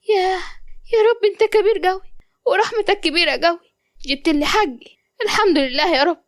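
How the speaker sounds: high-pitched, emotional thanks to God; overjoyed gratitude. Acted. Wronged mother thanks God for her justice, overjoyed.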